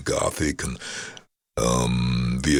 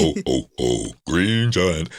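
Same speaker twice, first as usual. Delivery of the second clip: Green Giant jingle; 2 semitones higher, slower than usual. Shown as a demonstration. Demonstrates his Green Giant jingle.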